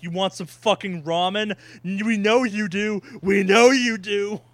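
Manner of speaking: pushy game narrator tempting the player. Retold or imitated. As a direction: lean in and needle them about the ramen. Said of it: "the game's gonna be like".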